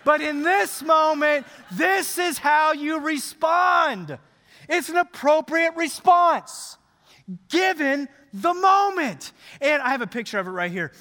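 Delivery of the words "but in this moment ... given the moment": high-pitched, slow proclamation; impassioned, stretched-out declaration; emphatic preaching. Real, not acted. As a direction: drive each phrase home to the congregation. Preacher proclaims the fitting response.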